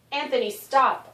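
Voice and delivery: cry out. Acted. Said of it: "I finally cried".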